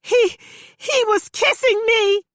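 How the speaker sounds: put-on high, loud terrified girl; screaming. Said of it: "Panda screamed".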